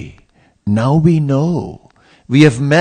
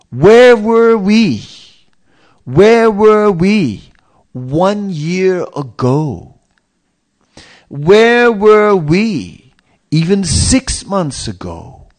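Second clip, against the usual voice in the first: higher, louder, slow rhetorical questions; retelling Kirtanananda; 5 semitones higher, 4 dB louder, slower than usual. Retold or imitated. Sannyasi speaking to devotees while they wait, slow and raised. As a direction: let each question ring out slowly. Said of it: "And Kirtanananda said".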